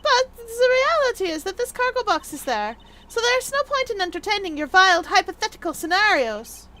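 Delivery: high-pitched, haughty character voice; high, exasperated rebuttal; indignant dismissal. Acted. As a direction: shoot the idea down with high, theatrical impatience.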